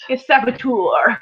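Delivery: drawn-out, playful accusing cry; mock accusation. Teasing Mark for accidentally clicking the button, mock accusation. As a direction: stretch it out to tease the culprit. Playfully calls out his slip.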